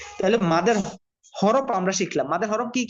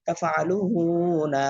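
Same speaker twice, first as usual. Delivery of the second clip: তাফআলুনা read as one word, long লু; joined reading; much slower than usual. Shown as a demonstration. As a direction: run তাফআলুনা together, holding the লু. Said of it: "চালু করে দিলে reading হয়ে যায়".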